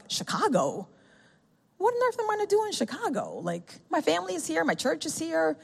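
high, soft dismayed disbelief; high, hushed panic at the news. Retold or imitated. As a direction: squeak it out in alarm. Panicked inner reaction to Chicago.

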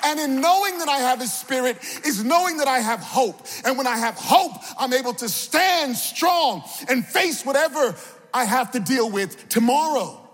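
high, rousing sermon delivery; impassioned preacher's call; preaching. Real, not acted. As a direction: exhort the congregation in rhythmic, falling phrases. Preaches with raised voice.

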